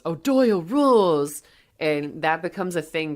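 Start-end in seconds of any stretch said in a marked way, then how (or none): 0.0-1.4 loud, higher, drawn-out victory yell; imitated bully yell. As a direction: crow it like a schoolyard bully. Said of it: "and yells".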